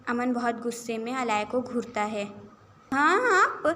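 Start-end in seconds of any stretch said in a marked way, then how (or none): 2.9-3.7 loud, high startled yelp. Acted. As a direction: blurt it out, caught off guard. Caught by her angry brother. (گھبرا کر چونکتے ہوئے)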